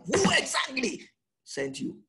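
high, slow, indignant demand; challenging shout. Retold or imitated. People challenge Moses, shouting it high and slow.

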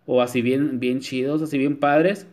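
ordinary delivery

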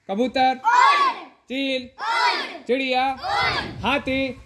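loud, high game calls to the class; calling out. Real, not acted. Teacher calls out game words.

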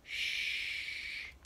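soft, drawn-out shush; gentle finger-to-lips shushing; hushing. A narrator's reading. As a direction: hush them with a long quiet shh. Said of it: "held her fingers up to her lips".

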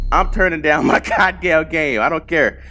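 ordinary talk, nothing marked

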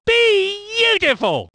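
high, drawn-out exclamation; slow, thrilled commentator cry; rapturous praise. Acted. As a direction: stretch the first syllable in delight. Commentator praise, stretched take.

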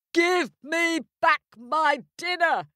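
high, loud giant's roar. Acted. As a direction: bellow it like a hungry giant. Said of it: "he roared".

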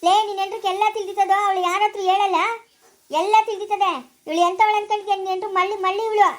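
loud, indignant scolding; angry accusation. Acted. Elder accuses Savitri of knowing. (ಕೋಪದಿಂದ ಆರೋಪಿಸುತ್ತಾ)

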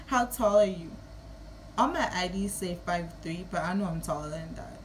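ordinary talk, nothing marked

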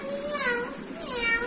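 high-pitched imitation of a cat; cat meow. Shown as a demonstration. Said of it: "Cat says".

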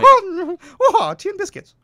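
high, loud, hammed-up squeal; mock panic yelp. Falling in game, mock panic.

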